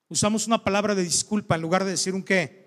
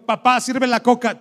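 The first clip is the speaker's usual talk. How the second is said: put-on demanding child; 5 semitones higher, 6 dB louder than usual. Child ordering dad, retold, raised voice.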